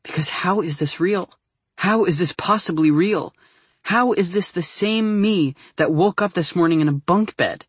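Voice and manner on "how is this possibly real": ordinary delivery, nothing marked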